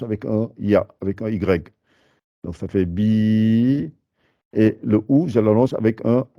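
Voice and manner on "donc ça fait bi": "bi" stretched to a long bii, slow; long vowel. Shown as a demonstration. Teacher shows the long i to students, slow. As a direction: hold "bi" for two beats. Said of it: "le temps de faire deux fois la lettre".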